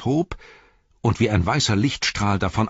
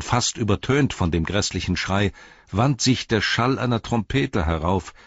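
ordinary delivery, nothing marked

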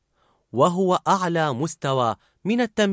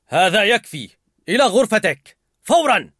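loud, higher scolding; harsh scolding; 7 semitones higher, 6 dB louder than usual. A narrator's reading. The father scolding Riley, sending her away. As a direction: order her out hard. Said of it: "فعنفها الأب بقوة".

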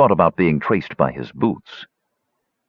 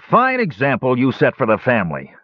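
higher-pitched grumbled scolding; harsh grumbling; 6 semitones higher than usual. A narrator's reading. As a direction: grumble it as a reproach to a brother. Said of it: "grumbled a harsh voice".

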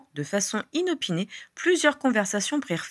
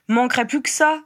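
higher, louder annoyed retort; cross grumble; 5 semitones higher, 5 dB louder than usual. A narrator's reading. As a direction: snap back in irritation. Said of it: "grogna Ginny". (grognement agacé)

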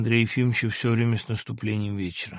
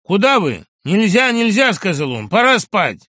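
louder, higher gruff refusal; orderly Nikita stopping the doctor; guard blocking the door; 6 semitones higher, 10 dB louder than usual. Acted. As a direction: bar his way, curt and firm. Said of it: "Никита вскочил и загородил".

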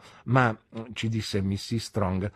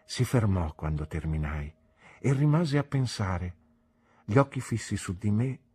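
plain reading voice

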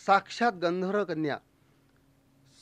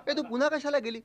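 much higher-pitched interrupting question; butting-in heckler; 7 semitones higher than usual. Acted. Neighbour interrupts the bathroom tale, high and challenging. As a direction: put on a nosy neighbour's challenge. Listener butts in, challenging him.

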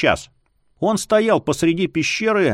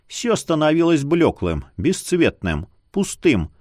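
ordinary delivery

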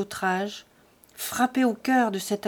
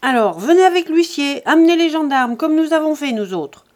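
loud, higher, defiant shouting; angry retort; 6 semitones higher, 9 dB louder than usual. A narrator's reading. Jean telling them to fetch the bailiff, shouting. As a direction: fling the challenge back.

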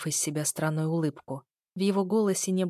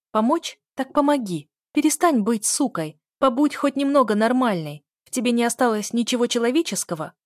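higher, louder indignant retort; angry outburst; 5 semitones higher, 6 dB louder than usual. A narrator's reading. Sister challenged on her offer to help, angry. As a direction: throw the words back angrily, raised voice. Acted quarrel between sisters.

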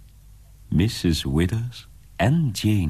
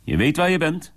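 brusque landlord; 10 semitones higher, faster than usual. Acted. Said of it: "zei hij".

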